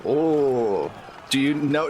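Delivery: usual speaking voice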